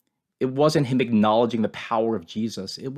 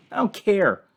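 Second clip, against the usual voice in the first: "I don't care" tossed off higher in scorn; mocking; 6 semitones higher than usual. Criminal on the cross taunting God, "I don't care" in scorn. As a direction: sneer "I don't care" like it means nothing. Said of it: "He mocks God and says basically".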